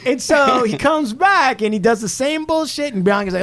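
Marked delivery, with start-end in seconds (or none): none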